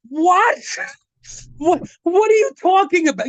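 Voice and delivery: high-pitched, incredulous reaction; acted-out shocked questioning; retold disbelief. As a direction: act out being stunned by a confession.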